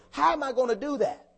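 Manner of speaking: ordinary talk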